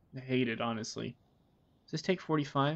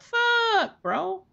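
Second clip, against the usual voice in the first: high, loud outburst; exasperated exclamation; 8 dB louder than usual. Retold or imitated. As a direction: burst out in disbelief. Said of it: "I'm like".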